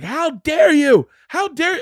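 high, slow, trembling outrage; shaking with anger. Retold or imitated. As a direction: sputter it in disbelief. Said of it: "she was like".

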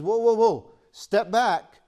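loud, high call to stop; urgent warning. Retold or imitated. James stopping the believer, loud urgent call. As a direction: cut them off loudly, whoa. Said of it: "James says".

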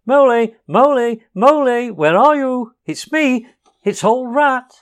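high, loud cheerful call into the woods; loud hopeful calling. A narrator's reading. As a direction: call out brightly for a lost friend. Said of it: "calling out cheerfully".

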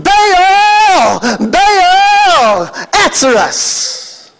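high, drawn-out wailing calls; shouting. Acted. Prophets dancing and shouting for hours, drawn-out shouted plea. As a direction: call out long, then sink on the plea. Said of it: "dancing, shouting".

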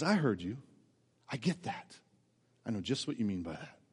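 soft, gentle voice of God, lightly voiced; reassuring God. God soothing a praying child, soft and reassuring. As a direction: speak comfort quietly, like a loving father. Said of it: "God says".

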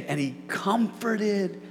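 drawn-out phrases with weighty pauses; slow, deliberate emphasis. Real, not acted. Preacher repeating the verse to land it, slow and deliberate. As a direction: spell out slowly who was comforted.

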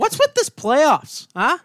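high, loud, drawn-out complaint; mock outrage. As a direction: gripe in exaggerated, high-pitched disbelief. Mock outrage over too much overtime.

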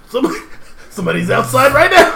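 high, loud urgent warning; hammed-up alarm; mock panic. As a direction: play at being nearly caught, half laughing. Mock slogan, panicked warning of being caught.

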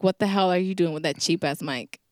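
ordinary delivery

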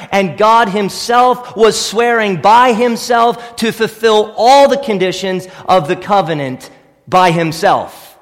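normal talking voice

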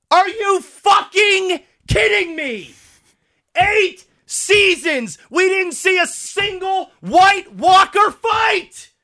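slow, high, exasperated shout; outraged disbelief. Real, not acted. Fan fed up after eight seasons, slow outraged shout. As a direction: drag out each word in real outrage.

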